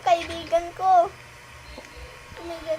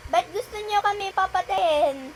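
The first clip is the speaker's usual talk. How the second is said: usual speaking voice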